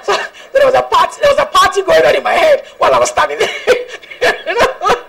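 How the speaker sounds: laughing through the words, excited; bubbling over with joyful laughter; giddy laugh while telling. Real, not acted.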